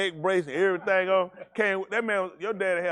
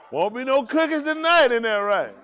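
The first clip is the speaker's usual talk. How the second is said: teasing; 6 semitones higher, 6 dB louder than usual. Pretend. Teasing about no cookies.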